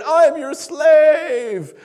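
a brother mock-bowing; mock groveling. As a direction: ham up the servile brother. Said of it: "bowing down to me and saying".

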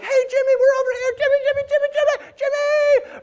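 high, loud yelling across a field; frantic high shouting; calling out. Retold or imitated. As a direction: shout for your teammate Jimmy. Teammates yelling for Jimmy.

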